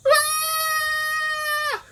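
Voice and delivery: very high, loud shriek; high scream. Shown as a demonstration. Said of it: "scream as high and loud as you can".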